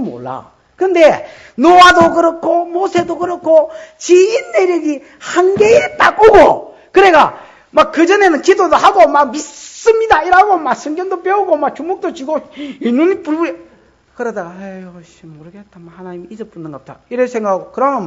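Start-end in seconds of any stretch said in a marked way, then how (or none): 0.8-13.6 loud, high, slowed preaching with laughter; preaching at full force. Acted. 설교자가 회중에게 열변하며, 크게 외치듯. 14.6-17.0 much softer, giving-up mumble; muttering despair. Acted. 낙심한 신자가 혼잣말로, 훨씬 작게 중얼거리듯.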